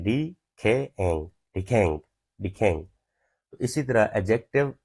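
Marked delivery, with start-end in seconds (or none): 0.0-1.3 डी खे एंग split into slow syllables. Shown as a demonstration. Teacher spelling out the syllables of the word. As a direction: break डी खे एंग into parts slowly. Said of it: "pronunciation देखें".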